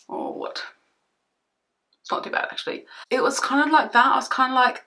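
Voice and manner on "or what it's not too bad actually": whisper. Real, not acted. Muttering while checking foundation shade.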